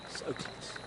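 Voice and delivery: breathy disappointed whisper. Real, not acted. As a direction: whisper it, deflated. Reacts to missed putt.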